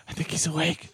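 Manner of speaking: whisper. Retold or imitated. Retelling her whisper about Nate.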